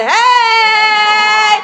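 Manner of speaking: long, high, loud holler; parade whoop. Acted. Puerto Rican marcher to the crowd, loud high whoop. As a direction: let out a drawn-out party yell.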